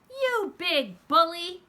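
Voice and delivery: angry yell. Acted. A kid, finally mad, yelling at the man who shoved him. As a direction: throw it at him from the gut. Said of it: "I yelled".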